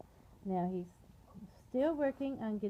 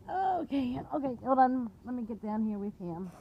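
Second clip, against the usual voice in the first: ordinary delivery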